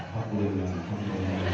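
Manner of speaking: low, soft, calm chant of praise; calm dhikr. Acted. Wife softly reciting dhikr as husband shouts, calm. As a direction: murmur it serenely while he rages.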